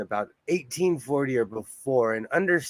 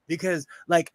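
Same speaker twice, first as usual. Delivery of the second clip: ordinary talk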